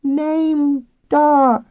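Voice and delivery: very high, loud dolphin squeal; talking dolphin. A narrator's reading. Dolphin character answering the man.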